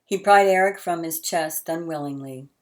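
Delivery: ordinary delivery, nothing marked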